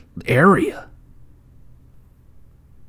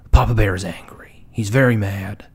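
ordinary talk

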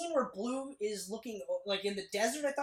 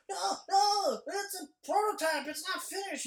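Beetle's panicky protest; 10 semitones higher, 4 dB louder than usual. Retold or imitated. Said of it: "Beetle's like".

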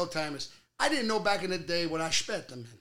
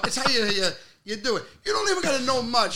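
ordinary talk, nothing marked

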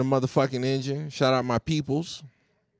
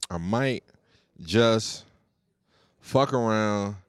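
ordinary talk, nothing marked

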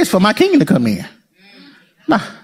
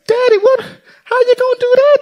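put-on much higher, shocked kid; childlike disbelief, higher; child voice. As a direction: play the startled little kid. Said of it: "I said".

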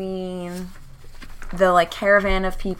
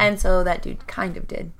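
normal talking voice

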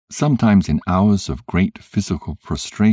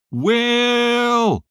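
calling out. A narrator's reading. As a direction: call out to wake him. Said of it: "I again called".

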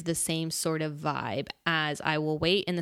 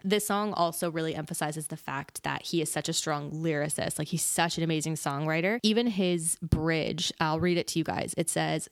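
normal talking voice

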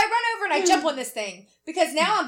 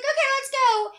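chirpy Jess; 12 semitones higher than usual. Retold or imitated. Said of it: "So Jess is like".

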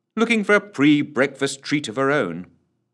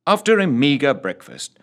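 ordinary delivery, nothing marked

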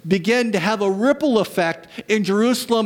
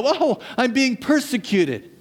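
high, exaggerated woe-is-me; mock self-pity; 5 semitones higher than usual. Preacher mimicking churchgoers teased at work, mock self-pity. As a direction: play up the victim, pitched higher.

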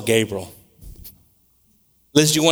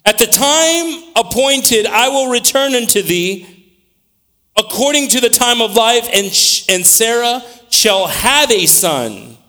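high, slow, drawn-out proclamation; preaching scripture; 10 semitones higher, 5 dB louder, slower than usual. Acted. Preacher reading the Lord's promise to Abraham, high, slow preaching. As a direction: stretch the key words in a raised preaching voice.

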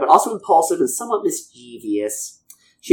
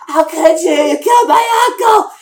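much higher, hammed-up shout of betrayal; retold betrayed outburst; 4 dB louder than usual. Amnesiac Jedi screaming at her master for killing her uncle, mock shout. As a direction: cry out at the master as a mock-distraught young Jedi. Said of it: "It was like".

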